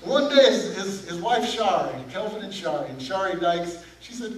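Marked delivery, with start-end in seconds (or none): none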